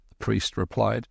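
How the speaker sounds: ordinary delivery, nothing marked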